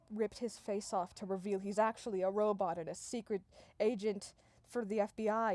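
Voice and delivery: plain reading voice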